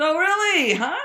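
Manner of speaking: high, loud incredulous outburst; loud shocked retort. Retold or imitated. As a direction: blurt it out in disbelief. Shocked reply to accusation.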